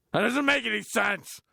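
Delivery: high, loud, strained disbelief; exasperated squeal. Real, not acted. Exasperated at the script, squealing in disbelief. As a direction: shriek it in frustration. Exasperated outburst at bad writing.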